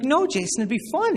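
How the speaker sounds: high, eager mock teacher voice; upbeat reassurance. Religion teacher coaxing a doubtful student, high and upbeat. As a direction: pitch it up to reassure, hammed-up.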